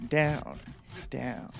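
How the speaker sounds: ordinary delivery, nothing marked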